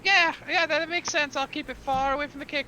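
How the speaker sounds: high, quick eager recruit voice; eager pirate recruit. Acted. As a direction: play a keen little would-be pirate, pitched high. Recruit promises spotless kitchen.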